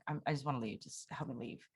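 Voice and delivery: breathless whisper, fading to nothing; barely voiced, begging to leave; desperate whisper. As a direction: plead in a hushed whisper. Desperate text to mom.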